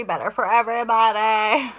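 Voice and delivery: loud, hammed-up burst of delight; playful exclamation. Real, not acted. Hammed cheer for everyone's good.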